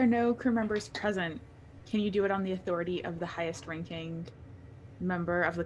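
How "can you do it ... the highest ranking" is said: ordinary talk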